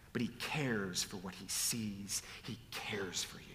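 soft whisper, nearly voiceless. Real, not acted. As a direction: breathe the words out quietly. Preacher whispers God's care.

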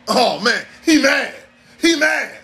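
high, loud teasing. Retold or imitated. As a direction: mimic a guy hooting at someone's anger.

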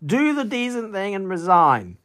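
a light Commons-debate voice; MP heckling in Commons. Retold or imitated. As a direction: ask it like an MP in the chamber. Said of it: "I want that floppy-head moron to resign".

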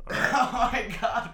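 slow laughing exclamation; drawn out through laughter. Real, not acted. Laughing at slur.

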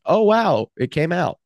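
high, delighted "oh, wow"; surprised. Retold or imitated. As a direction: pleasantly caught off guard.

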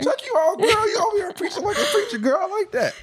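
very high, loud falsetto praise; over-the-top hyping. Real, not acted. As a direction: egg her on in an excited squeal. Cheering partner's speech like a congregation.

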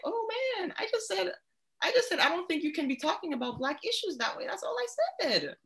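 high, small protesting voice; mock innocent student. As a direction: plead innocence in a high little voice. Plays the scolded student protesting innocence.

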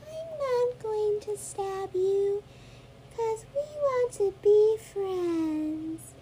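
high, very slow, eerily calm doll; too calm creepy doll. Pretend. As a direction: soothe her like a sinister doll. Doll reassures Emma.

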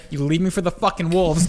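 ordinary talk

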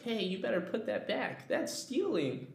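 a hint of a scolding kid sister; bossy little sister. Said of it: "my little sister's like".

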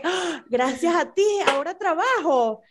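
rising thankful lilt on "gracias a ti", warm fall on "trabajo"; grateful reading. Shown as a demonstration. Friend demonstrating how thanks should sound to her coworkers. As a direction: say it as sincere thanks, voice lifted. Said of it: "la connotación es importantísima".

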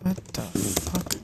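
normal talking voice

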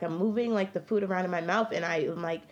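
ordinary delivery

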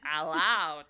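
playful Carol Channing hello; Carol Channing impression. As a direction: croon a showbiz hello. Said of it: "Now I'm doing Carol Channing".

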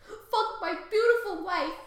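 an enraged king bellowing between laughs; furious shouting king. Acted. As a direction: scream at him to get in the tower. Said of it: "I'm mad at you".

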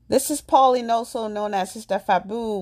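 ordinary delivery

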